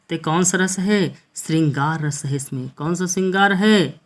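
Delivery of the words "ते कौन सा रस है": ordinary talk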